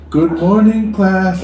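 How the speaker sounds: chirpy schoolroom greeting, a hint of a radio host; radio teacher greeting. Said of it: "they'd say".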